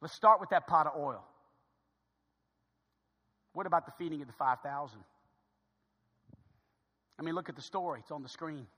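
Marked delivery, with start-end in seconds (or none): none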